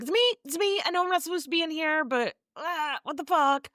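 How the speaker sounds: high, fast mock-panicked babble; hammed-up high-pitched fright; startled panic. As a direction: blurt it out like someone caught where they shouldn't be. Startled man springs out of bed.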